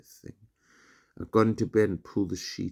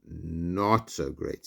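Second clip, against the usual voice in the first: very slow, drawn-out, falling Not so great; mock dread; much slower than usual. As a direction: drag Not so great out like fainting. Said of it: "pretending to pass out on his bed".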